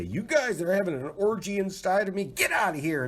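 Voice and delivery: high mock scolding of germs; mock telling microbes to leave. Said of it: "to say".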